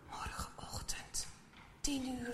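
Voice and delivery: hushed whisper, barely voiced. A narrator's reading. Maarten whispering the hour to himself. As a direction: whisper it so no one overhears. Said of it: "fluistert hij".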